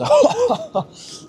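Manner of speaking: very high-pitched mock guffaw; imitated loud laughter. Imitating loud Americans in a dining area, high mock laugh. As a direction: mimic diners laughing at the top of their lungs. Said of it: "you just hear people laughing, like, top of their lungs".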